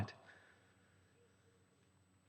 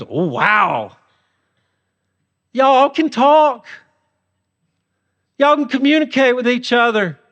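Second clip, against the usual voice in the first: hammed-up sarcastic wonder; sarcastic God; 4 semitones higher than usual. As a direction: tease them like a parent praising toddlers. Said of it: "He's being sarcastic".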